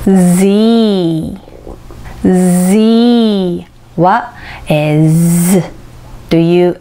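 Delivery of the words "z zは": Z said as a voiced z plus E; Zをはっきり発音; English letter Z. Shown as a demonstration. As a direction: sound out Z clearly, teacher style. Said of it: "Eという母音からできています".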